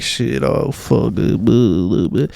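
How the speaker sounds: sloppy blah-blah babble; mock a careless, foul-mouthed talker; crude blabbering. Shown as a demonstration. Showing how a speaker gets judged, crude blabbering. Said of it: "talking like that".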